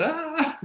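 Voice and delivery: very high short scream of fright; frightened shriek. Retold or imitated. Gopi freaking out at Krishna's threat, shrieking. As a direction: let out a sudden high shriek.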